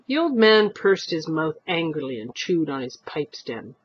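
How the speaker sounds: usual speaking voice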